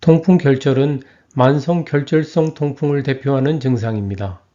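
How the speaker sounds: normal talking voice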